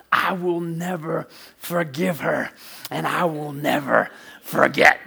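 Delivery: slow, mock-solemn vow of anger; retold fury. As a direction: imitate a seething brother, drawing out each word. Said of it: "He was so mad. He's like".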